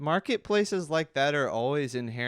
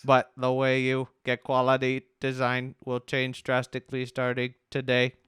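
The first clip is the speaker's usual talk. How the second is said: level, monotone robot reading. Pretend. Reading a homepage aloud on a co-host's request, robot voice. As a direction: read it like a robot, on one even pitch. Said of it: "Can you read this in a robot voice?".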